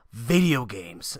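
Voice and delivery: slow, drawn-out mock verdict; mock pronouncement. Quoting the guy's conclusion on shootings, slow mock pronouncement. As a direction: draw the words out as a mocking conclusion.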